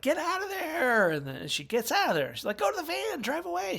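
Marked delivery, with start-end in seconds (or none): 0.0-1.1 high, loud holler at the screen; mock viewer yell. Horror viewer urging heroine to flee, high mock yell. Voices viewer yelling at character. 2.6-3.8 high-pitched pleading command; mock viewer urging. Horror viewer telling heroine to escape, high mock urging.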